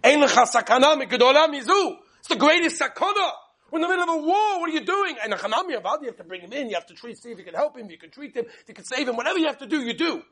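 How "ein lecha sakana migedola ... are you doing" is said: louder, higher, disbelieving; incredulous soldier. Retold or imitated. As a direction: scoff at the question as absurd. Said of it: "He said".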